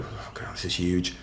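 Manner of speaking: whispered "Oh God", then a hushed "this is huge"; retold, breathy hushed panic; hushed aside. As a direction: mutter it under your breath, awed. Retold fear on the table.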